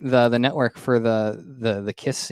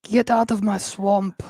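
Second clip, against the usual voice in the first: higher, slow put-on Scottish brogue; Shrek's Scottish accent; 9 semitones higher, slower than usual. Quoting the ogre to a friend, hammed-up Scottish accent.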